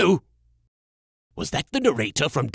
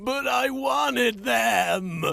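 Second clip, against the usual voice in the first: whiny wail; 9 semitones higher, much slower than usual. Acted. Crying over lost god cards.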